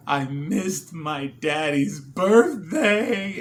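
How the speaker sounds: loud, drawn-out mock wail; imitating upset child. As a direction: wail it slowly like a heartbroken little girl. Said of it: "she was upset, upset".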